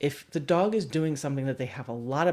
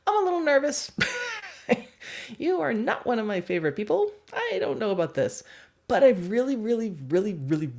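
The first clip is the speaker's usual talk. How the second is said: much higher, timid dog voice with a nervous laugh; nervous little dog; 7 semitones higher than usual. Retold or imitated. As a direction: play the tiny dog torn between fear and treats. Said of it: "our tiny little dog here is like".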